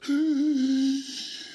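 high gasping breath in; imitated sharp gasp. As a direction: gasp like a man saved. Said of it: "he goes".

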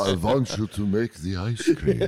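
slow, drawn-out Transylvanian hypnotic voice; mock Transylvanian glamour. Playing a vampire glamouring the worker, slow Transylvanian drawl. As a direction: slowly cast a Transylvanian spell. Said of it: "Why are you talking like that? Is that a Transylvanian accent".

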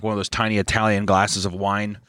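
ordinary talk, nothing marked

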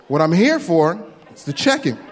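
ordinary talk, nothing marked

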